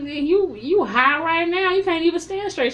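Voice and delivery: high, loud disbelieving yell; loud, high-pitched call-out at a stumbling man; shouting at the screen. Retold or imitated. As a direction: yell it at him, stunned. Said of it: "I'm like".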